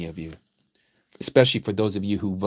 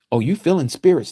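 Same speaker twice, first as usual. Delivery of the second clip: ordinary talk, nothing marked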